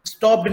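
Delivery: स्टॉप्ड ending in a voiced "d". Shown as a demonstration. Teacher showing what not to say, स्टॉप्ड with a voiced d. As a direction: wrongly close स्टॉप्ड on a d sound. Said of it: "ये नहीं कि हम ड की तरह pronounce करेंगे".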